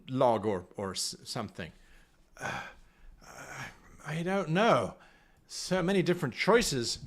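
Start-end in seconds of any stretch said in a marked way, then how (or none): none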